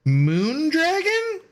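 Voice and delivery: long, high rising exclamation of disbelief; incredulous surprise. Real, not acted. Reacting to Moondragon in the game, high drawn-out surprise. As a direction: stretch the name upward in disbelief.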